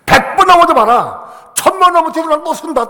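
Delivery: defiant cry. Acted. Defying the devil in sermon.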